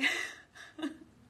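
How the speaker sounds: high, loud giggle bursting out; bursting laugh. Real, not acted. Teacher cracking up mid affirmation, high laugh. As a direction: laugh breaking through the words. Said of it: "can't even say it without laughing".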